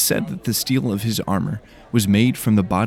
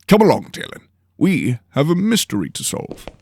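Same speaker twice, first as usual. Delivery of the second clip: higher, grand noble voice; pompous lord calmly explaining; Lord Henley, lofty; 8 semitones higher, 4 dB louder than usual. Acted. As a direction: lecture your apprentice grandly.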